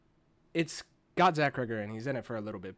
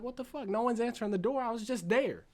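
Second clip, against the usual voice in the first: higher, exasperated mock woman's voice; mock Tess; 6 semitones higher than usual. Tess knocking on the unanswered door, higher and exasperated. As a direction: pitch it up like an annoyed young woman. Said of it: "She's like".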